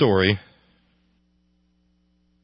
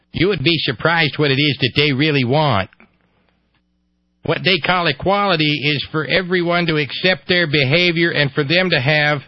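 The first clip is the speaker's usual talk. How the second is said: higher, mock Ohio accent; ignorant Ohio voice; 6 semitones higher, 3 dB louder than usual. Host quotes an Ohio activist, ignorant Ohio voice. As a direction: pitch it up as an ignorant Ohioan. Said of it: "my ignorant Ohio voice".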